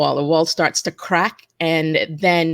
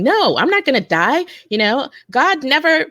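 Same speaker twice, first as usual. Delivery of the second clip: high, urgent mock yell; retold yelling; 11 semitones higher than usual. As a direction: yell it out in desperate faith. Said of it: "I started yelling".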